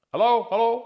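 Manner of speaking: high, ringing hello into the empty room; testing the echo. Shown as a demonstration. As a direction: call out to hear the echo. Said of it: "hello, echoey".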